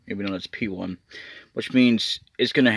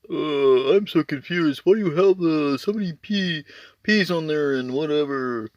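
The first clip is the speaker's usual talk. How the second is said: silly confused viewer; 6 semitones higher, 3 dB louder than usual. Retold or imitated. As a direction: poke fun at a baffled fan asking why. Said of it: "it's a silly accent".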